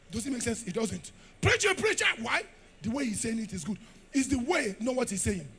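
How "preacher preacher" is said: high, loud chant of praise; mock cheering fan. Crowd cheering the eloquent preacher, mock excited.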